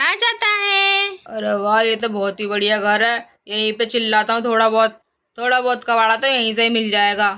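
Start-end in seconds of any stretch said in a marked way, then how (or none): 1.1-7.3 much lower-pitched character voice; low musing to himself, sizing up a prospect; scrap dealer, pleased. Acted. (कबाड़ी की नीची, खुश आवाज़)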